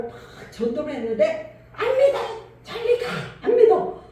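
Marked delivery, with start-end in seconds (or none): 1.7-4.0 high, loud mock yell of a dying old woman; retold shouting refusal. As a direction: bark refusal half laughing while retelling. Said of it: "막 고함을 저한테 지르면".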